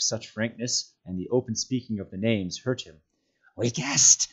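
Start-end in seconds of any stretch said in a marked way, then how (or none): 3.6-4.3 forceful creature stage whisper; harsh, voiceless creature whisper. A narrator's reading. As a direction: hiss it in a loud whisper. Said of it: "he whispered".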